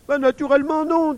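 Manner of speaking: put-on high, loud boyish exasperation; exasperated. A narrator's reading. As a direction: answer as if the question were absurd. Émile exasperated by cashier's questions.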